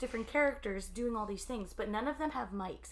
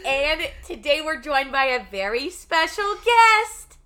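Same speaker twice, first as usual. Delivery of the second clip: loud, high radio host intro; announcer voice; 10 semitones higher, 19 dB louder, slower than usual. Shown as a demonstration. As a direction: boom it out like a show host. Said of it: "I really wanna do an announcer voice".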